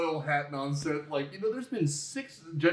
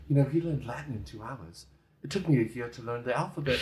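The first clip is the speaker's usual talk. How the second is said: low-pitched, half-hearted attempt at an Irish accent; Pierce Brosnan's Irish accent; 5 semitones lower, faster than usual. Host quoting Pierce on learning Latin, shaky-confidence Irish accent. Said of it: "I can't do his Irish accent, but Pierce goes".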